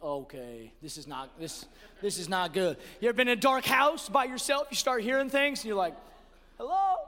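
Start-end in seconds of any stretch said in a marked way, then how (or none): 0.0-2.8 low, hushed mock unease; acted quiet nervousness; uneasy. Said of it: "starting to get dark". 6.6-6.9 high, tentative call into the dark; hammed-up nervous hello; mock scared. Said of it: "until I'm alone and scared".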